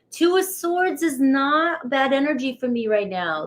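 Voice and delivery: usual speaking voice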